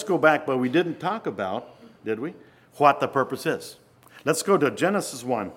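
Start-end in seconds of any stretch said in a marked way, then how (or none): none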